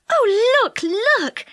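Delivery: high, loud cry of delight; thrilled child yelling at elephants; excited yell. A narrator's reading. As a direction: shout it out, nearly mad with excitement. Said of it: "yelled Fanny".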